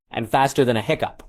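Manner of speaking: ordinary delivery, nothing marked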